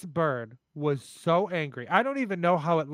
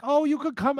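higher sweet coaxing; lightly voiced grandma; 11 semitones higher, 4 dB louder than usual. Grandma calls narrator back in, high sweet coaxing. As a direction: coax her back inside. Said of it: "And Graham's like".

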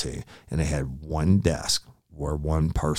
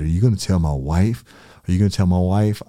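mock pleading; 4 semitones higher, 7 dB louder, faster than usual. Retold suspect pleading about his wife.

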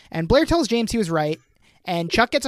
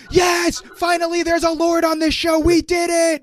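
mock fan cheer; 10 semitones higher than usual. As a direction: cheer like a thrilled fan at last. Fans cheering the lord reveal.

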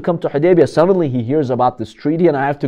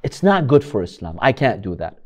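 ordinary talk

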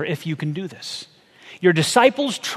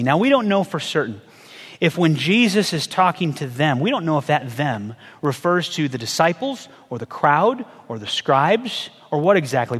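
ordinary talk

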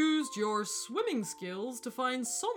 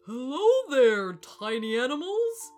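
pompous booming hello; 2 semitones higher, 10 dB louder than usual. A narrator's reading. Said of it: "boomed the elephant".

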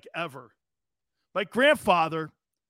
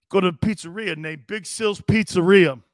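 ordinary talk

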